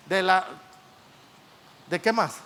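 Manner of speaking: normal talking voice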